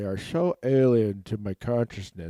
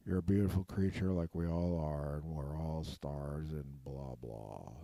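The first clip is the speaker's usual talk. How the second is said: hushed, soft meditation voice, trailing away at the end; half-mocking guided relaxation read; 4 semitones lower, 9 dB quieter than usual. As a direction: murmur it like a calming guru talking someone down.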